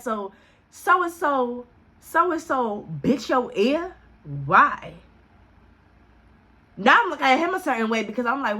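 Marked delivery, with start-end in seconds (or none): 0.0-4.9 slow, high-pitched disbelief; incredulous. Retold or imitated. Storyteller questioning guy about bitten ear, incredulous. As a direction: stretch out the question, pitch climbing in astonishment. Retold disbelief at who bit him.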